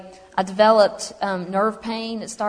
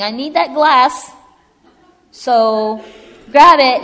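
loud, raised-pitch pretend demand; emphatic demand; 4 semitones higher, 10 dB louder, much slower than usual. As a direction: act out insisting on something out of reach. Imagined demand in her kitchen.

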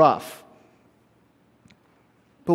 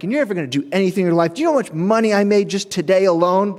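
rising, self-important tirade; mock a boastful customer's mounting ire; irate lawyer; 5 semitones higher, 4 dB louder, faster than usual. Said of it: "full front ire of this man".

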